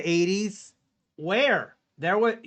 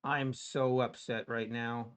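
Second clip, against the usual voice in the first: low, soft and deflated; dejected murmur; quiet upset; 7 semitones lower, 10 dB quieter than usual. Real, not acted. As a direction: sigh the words out. Losing trade.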